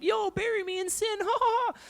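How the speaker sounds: high cartoon fish voice with giggles; laughing fish voice. Youth pastor's fish laughing while sinking into sin.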